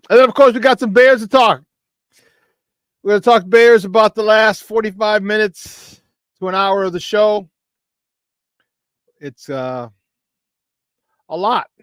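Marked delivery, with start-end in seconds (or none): 0.1-7.5 louder and higher, pumping topics; host amped about the lineup; excited rundown. Real, not acted. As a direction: fire up the audience. Hyping Bears segment.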